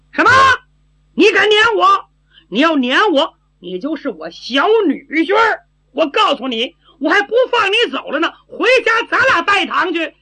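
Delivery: put-on high, pushy silly-girl outburst; simple-minded girl, indignant. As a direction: refuse to be sent away, high and stubborn. (傻丫头高声不依不饶)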